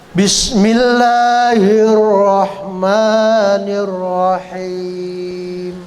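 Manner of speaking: slow drawn-out melodic chant; Quran recitation. Shown as a demonstration. Teacher reciting the whole surah for students. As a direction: recite the surah slowly with tajwid.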